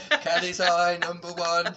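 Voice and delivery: calling out bingo numbers; bingo caller. Shown as a demonstration. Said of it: "I used to do a bit of bingo calling".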